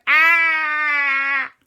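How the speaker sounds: loud, high, sustained yell; acted scream. Quasi screams on the roller coaster, long high yell. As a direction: yell all the way down the drop.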